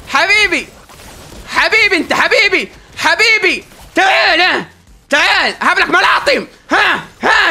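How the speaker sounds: high, loud sing-song taunt of habibi; real playful gloating; mocking taunt. Real, not acted. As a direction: gloat at the beaten enemy. Taunting enemy he just beat.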